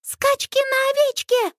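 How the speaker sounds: put-on very high little-girl voice; squeaky, insistent child's demand; stubborn toddler yell. A narrator's reading. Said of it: "упрямо орёт вдруг Минни".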